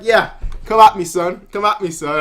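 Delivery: cocky taunt. Retold or imitated. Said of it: "Just like".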